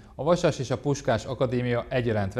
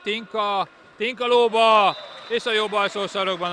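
excited sports commentary; 6 semitones higher, 4 dB louder than usual. Real, not acted. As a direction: call the shot with rising thrill. Live play-by-play, goal. (izgatott sportkommentár)

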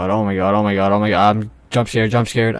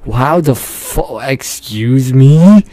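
loud, drawn-out, soaring question; affronted, rising exclamation; incredulous; 2 semitones higher, 6 dB louder, much slower than usual. Real, not acted. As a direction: stretch it slowly upward in disbelief. Baffled by what the game shows.

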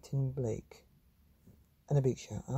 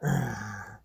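monster growl; 4 semitones lower than usual. Acted. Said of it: "they said".

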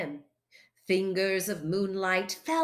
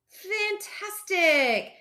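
high, drawn-out fake enthusiasm; fibbing; 10 semitones higher, much slower than usual. A narrator's reading. Foxes lying to Fosdyke about hunting, fibbing. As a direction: stretch it out too brightly to be true. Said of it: "they fibbed".

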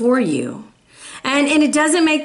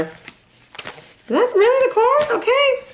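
surprised disbelief; 12 semitones higher, slower than usual. Real, not acted. Surprised at the card drawn.

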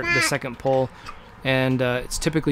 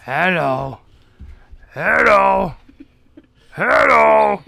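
cave echo; 6 semitones higher, 8 dB louder, much slower than usual. Pretend. Calling hello back and forth.

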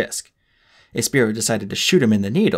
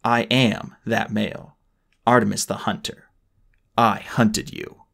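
slow and deliberate; slower than usual. Acted. Artemis's slow triumphant reveal.

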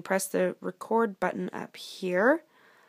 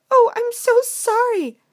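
high, loud, drawn-out apology; apologetic princess; 11 dB louder, slower than usual. Acted. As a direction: plead as a startled princess. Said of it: "said the princess".

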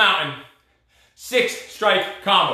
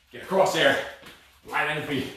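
normal talking voice

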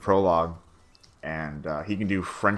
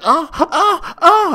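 much higher, loud falsetto "oh, oh, oh"; mock moaning; 10 dB louder, much slower than usual. Nikki in the script with the dildo, mock moaning falsetto. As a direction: ham up a silly moan. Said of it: "She's like".

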